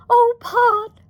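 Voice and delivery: high, loud, mildly tearful appeal. A narrator's reading. Wife appeals to her husband, tearful. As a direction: voice lifted with a touch of tears. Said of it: "her eyes full of tears".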